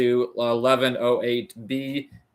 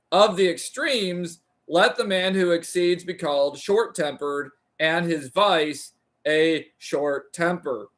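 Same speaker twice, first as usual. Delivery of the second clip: raised, deliberate reading of a philosophy text; formal reading aloud; 5 semitones higher than usual. Acted. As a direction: read it like a careful lecture recitation. Reads Aristotle translation aloud.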